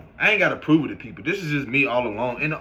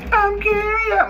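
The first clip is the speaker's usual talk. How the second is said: squeaky high-pitched slow "I'm curious"; drawn-out falsetto mockery of nosy people; 4 dB louder, slower than usual. Mocking nosy people in a high squeaky voice. Mock voice again.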